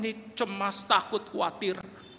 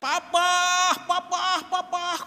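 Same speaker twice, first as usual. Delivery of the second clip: very high calls, drawn out; child's scream; 4 dB louder, much slower than usual. Acted. Child trapped upstairs calling father, high screaming. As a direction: cry out for daddy from upstairs. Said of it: "dia teriak".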